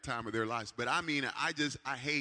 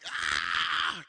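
mock yell; 8 semitones lower, 7 dB louder than usual. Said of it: "they're like".

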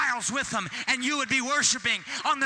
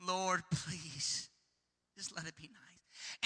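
soft, low, slow little prayer hoping for calm; nervous hushed prayer; 7 semitones lower, 12 dB quieter, slower than usual. Retold or imitated. Bringing a friend to church, hushed nervous prayer. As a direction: pray under your breath for a tame service. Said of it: "and you're like".

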